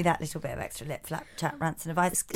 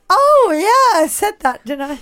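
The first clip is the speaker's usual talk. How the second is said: mock-innocent, much higher and louder; imitating co-host; 8 semitones higher, 6 dB louder than usual. Teasing her co-host about forgetting the story, mock-innocent. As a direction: play dumb as her friend. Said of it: "'Cause you sort of went".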